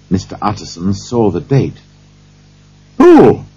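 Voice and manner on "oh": loud, high cry of surprise; startled cry. A narrator's reading. Lawyer sees the date on the note, cries out. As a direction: cry out sharply, pitch leaping up. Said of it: "he cried".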